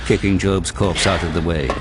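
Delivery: ordinary talk, nothing marked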